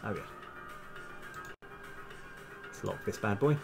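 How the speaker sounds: normal talking voice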